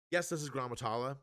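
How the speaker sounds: ordinary talk, nothing marked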